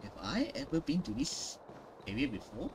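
ordinary talk

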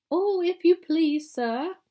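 Mrs Beaver. A narrator's reading. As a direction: squeak with grateful excitement. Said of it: "said Missus Beaver".

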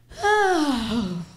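a long drawn-out weird yawn; imitated yawn. Comic mimicking a train stranger's weird yawn. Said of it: "he just goes".

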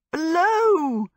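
loud, high cry of frustration; high exasperated shout. A narrator's reading. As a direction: cry it out like a curse. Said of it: "she cried".

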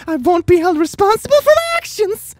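very high, loud falsetto rant; hammed-up squeaky scheming; mock schemer. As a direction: crow it like an overexcited plotter. Acts out a scheming character.

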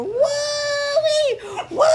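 long, high, loud whooping; drawn-out woo-hoo climbing high; yelled whoop. Real, not acted. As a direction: whoop it out at full voice. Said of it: "Don't yell".

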